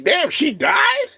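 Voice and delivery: high, slow, stunned cry; shocked disbelief. Retold or imitated. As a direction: let it crack with disbelief. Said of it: "I'm like".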